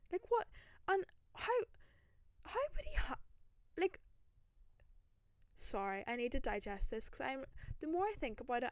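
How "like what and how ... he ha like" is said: high, louder shocked squeak; rising shocked yelp; disbelief. Real, not acted. Disbelief at the call.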